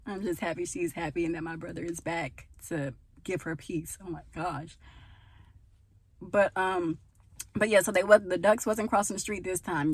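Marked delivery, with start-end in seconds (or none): none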